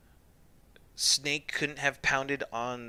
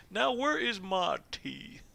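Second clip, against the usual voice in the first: high, slow mock British snob; British highbrow; 8 semitones higher, slower than usual. Player imitating smug Snake wanting tea, British highbrow accent. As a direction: ask it like a pampered British highbrow. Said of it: "that kind of voice".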